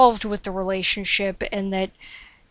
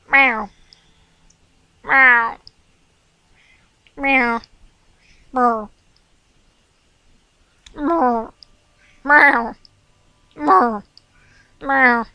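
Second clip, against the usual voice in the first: higher, louder playful meows; meowing at the cat; 3 semitones higher, 6 dB louder, much slower than usual. Pretend. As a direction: keep meowing to get his attention. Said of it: "meowing into the microphone right now like a doofus".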